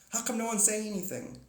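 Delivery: usual speaking voice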